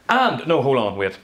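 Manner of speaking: loud, high cut-in, then dropping in pitch; interrupting director. Acted. Director stopping an actor after two words, abrupt loud interruption. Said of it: "which some directors do".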